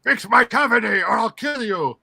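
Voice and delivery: a cartoon brute making threats; menacing alien voice. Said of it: "He's like".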